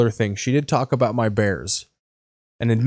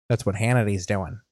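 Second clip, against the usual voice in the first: normal talking voice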